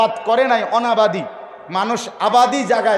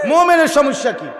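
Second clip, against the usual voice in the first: loud, raised rhetorical question; forceful challenge; 4 semitones higher, 4 dB louder than usual. Real, not acted. Preacher asking what trouble a believer has, forceful challenge. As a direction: throw it out loudly at the crowd.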